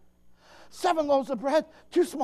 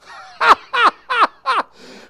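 high, loud burst of spaced-out laughs; loud staccato laugh; 10 semitones higher, 11 dB louder than usual. Acted. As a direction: laugh it out in hard, separate beats. Comic outburst after the shouted claim.

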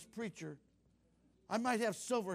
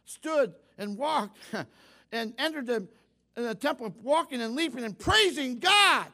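loud, raised, exultant proclamation; full-force preaching; 6 semitones higher, 9 dB louder than usual. Real, not acted. Preacher rejoicing over the healed man praising God, full-force preaching. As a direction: belt it out to the congregation, building to a shout.

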